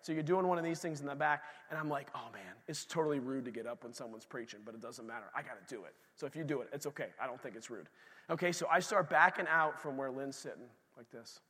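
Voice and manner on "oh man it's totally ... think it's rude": much softer, lower musing; thinking to himself, softly; inner monologue. Retold or imitated. Preacher reliving his thoughts while leaving the service, much softer.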